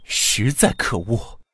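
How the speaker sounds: slow angry disgust. A narrator's reading. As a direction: spit it out slowly in anger. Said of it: "贾琏生气地说".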